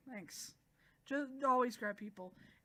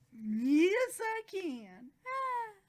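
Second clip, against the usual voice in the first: high, loud drawn-out squeal; mock overjoyed; 9 semitones higher, 8 dB louder, much slower than usual. She tells Subaru she can talk, squealing excitedly. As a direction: squeal it out in happy triumph.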